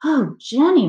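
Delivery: high, loud dismayed mother; crying out. A narrator's reading. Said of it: "my mother cried".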